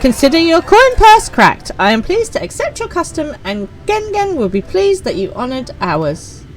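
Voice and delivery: high, theatrical shopkeeper voice; sly market merchant sealing the deal. Acted. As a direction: play the pleased trader. Voicing merchant NPC.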